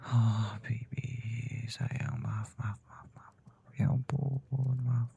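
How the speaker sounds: slow, hushed, remorseful whisper; whispered apology. Acted. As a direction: breathe the sorry right by her ear. Husband apologizing to crying wife. (bisikan minta maaf kepada istri)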